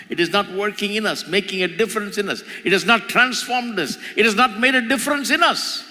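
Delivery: rising pulpit fervor; impassioned preaching. Acted. Preaching builds to full force.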